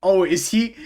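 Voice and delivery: normal talking voice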